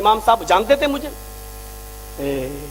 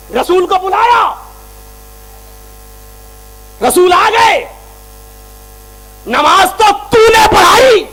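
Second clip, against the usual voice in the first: loud, much higher shouted accusation, slow in places; angry rebuke; 8 semitones higher, 8 dB louder than usual. Retold or imitated. Speaker confronting the imam in the mosque, furious shouting. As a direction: tear into him line by line.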